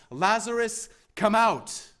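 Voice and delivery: calling loudly into a tomb; commanding shout to a dead friend; loud cry. Acted. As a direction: cry the name out, loud and high. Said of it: "cried out with a loud voice".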